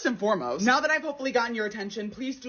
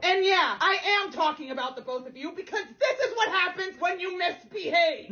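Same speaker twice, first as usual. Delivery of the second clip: mock scolding; 8 semitones higher than usual. Scolding her cats playfully.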